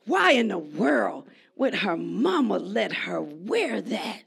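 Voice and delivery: low, soft judging aside; hushed scandalized mutter; disapproving mutter. Retold or imitated. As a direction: mutter it like a disapproving church lady. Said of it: "I say".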